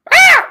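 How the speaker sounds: piercing goat scream, very high and loud. Shown as a demonstration. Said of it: "There's my Screaming Goat impression".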